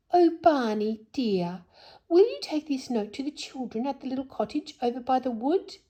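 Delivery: high, coaxing sweet request; sweetest voice. Acted. Silky sweetly coaxing the barn owl to carry a note. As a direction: sweet-talk him into the favour. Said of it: "asked Silky in her sweetest voice".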